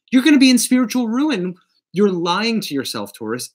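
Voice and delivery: high, loud, fast and forceful; emphatic. Real, not acted. Reader warning Taurus, emphatic and high. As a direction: preach it like taking them to church. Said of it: "when I get emphatic like this".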